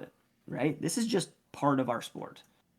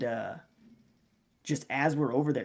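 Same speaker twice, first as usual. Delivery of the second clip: ordinary delivery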